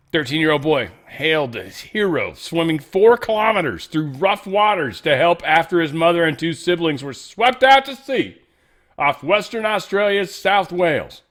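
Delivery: high, loud, punchy headline delivery; news headline read. Acted. Host announcing a boy's rescue swim, high loud news read. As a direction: belt out the headline like breaking news.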